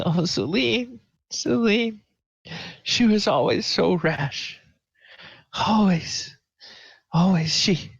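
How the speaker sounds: high, tearful lament for a lost sister; weeping. Acted. Grieving girl mourns her rash dead sister Celine, weeping. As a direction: grieve over her sister through tears, voice breaking. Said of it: "you can tell that she's weeping".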